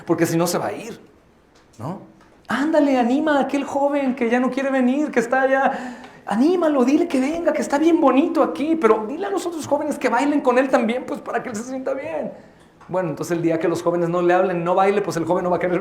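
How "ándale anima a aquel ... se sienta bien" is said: high-pitched coaxing plea. Acted. Member urging another to bring the young man back, coaxing. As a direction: coax them in a raised pitch.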